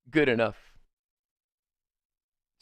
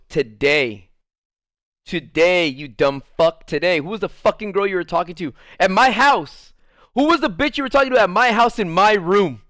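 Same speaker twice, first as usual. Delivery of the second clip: rising, louder accusing rant; angry accusation; 3 semitones higher, 4 dB louder than usual. Retold or imitated. Girlfriend accuses him over a girl, building angry rant. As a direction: hammer him with jealous questions.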